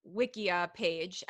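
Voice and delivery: normal talking voice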